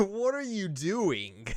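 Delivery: high, slow, laughing what are you doing; cracking up in disbelief. Real, not acted. Laughing at Naoto's choices, high and slow.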